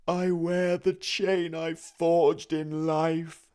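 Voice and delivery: high, loud, slow mournful spirit voice; wailing ghost. A narrator's reading. The ghost lamenting its fate to Scrooge, high and wailing. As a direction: moan it like a doomed soul.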